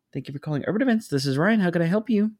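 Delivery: bright customer-service pickup, rising high at the end; phone greeting. Retold or imitated. Answering a caller on the office line, receptionist greeting.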